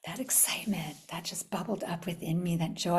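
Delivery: soft intimate whisper of joy; hushed whisper about the joy inside. Real, not acted. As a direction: breathe it like a secret.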